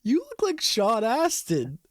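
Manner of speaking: high, slow put-on lady voice; imitating the lady. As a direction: mimic the cashier sweetly. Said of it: "she looks to James and says".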